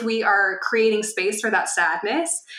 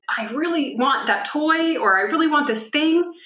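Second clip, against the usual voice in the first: put-on whiny child; 7 semitones higher than usual. Child wanting a toy.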